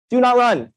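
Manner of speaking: "Do not run" pitched high, falling firmly at the end; high, emphatic stress on "Do not"; emphatic repeat. Shown as a demonstration. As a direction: drive home "Do not run" as a firm slogan. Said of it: "sound for emphasis".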